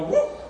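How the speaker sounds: sudden very high yelp; a silly high whoop from the prophet; mock whoop. Prophet's mock healing gesture.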